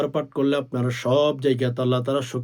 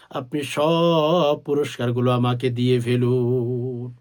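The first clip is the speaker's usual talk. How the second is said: ordinary delivery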